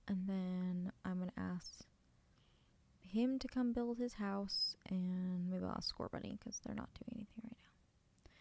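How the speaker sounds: soft unvoiced whisper. Real, not acted. Streamer quietly narrating her building, whispering. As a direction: whisper it while you work. Whispering while giving game orders.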